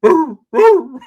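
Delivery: high, loud woofing; old man barking like a dog. Retold or imitated. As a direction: bark like a puppy. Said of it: "He's like".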